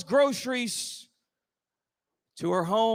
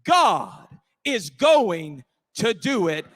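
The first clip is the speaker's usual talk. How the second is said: slow, lower declaration with pauses; slow, emphatic preaching; 5 semitones lower, 5 dB louder, slower than usual. Real, not acted. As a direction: land each phrase separately, with weight. Slow, deliberate preaching punch.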